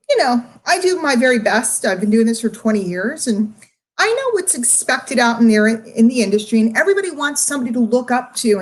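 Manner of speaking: usual speaking voice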